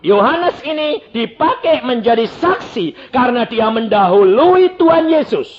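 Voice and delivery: loud, high, hammering sermon delivery; full-force preaching. Real, not acted. Preacher explains John's witness to the congregation, full-force preaching. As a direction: preach at the top of your force, pitched high.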